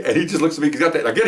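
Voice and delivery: normal talking voice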